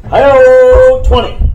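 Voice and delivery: high, loud triumphant cheer; a player celebrating a great roll, loud and high. Real, not acted. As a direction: crow over the lucky roll.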